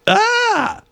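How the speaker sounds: sudden high yelp of a laugh; a laugh escaping mid-read; laugh bursting out. Real, not acted. Stifled laugh breaking out.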